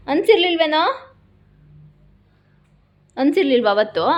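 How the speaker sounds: scolding. Real, not acted. Owner asking the dog where it was two days, scolding. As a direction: demand answers from a naughty pet. Scolding pet, demanding its attention.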